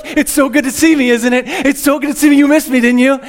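smug, high pampered-pet voice; vain little dog voice. Retold or imitated.

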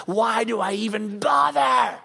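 mock exasperated cry about an ungrateful person; weary exasperation. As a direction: throw up your hands, worn out from caring. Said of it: "you're like".

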